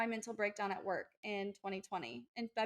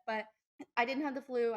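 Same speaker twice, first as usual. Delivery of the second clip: ordinary talk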